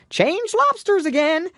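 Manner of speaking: high, loud yell at the top of his voice; yelling at full voice. A narrator's reading. Gryphon yelling the dance call, loud and high. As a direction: yell it as loud as you can, pitched high. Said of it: "yelled the Gryphon at the top of his voice".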